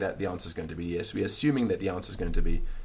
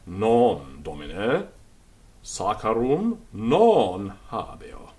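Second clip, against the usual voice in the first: slow model sentence; much slower than usual. Shown as a demonstration. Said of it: "repeated model sentence".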